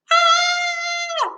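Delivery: put-on high shrill cry of a little girl; distressed squeal. Little sister shrieks as her doll is taken, high cry. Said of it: "made a sound of distress".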